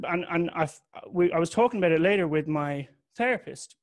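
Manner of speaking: ordinary talk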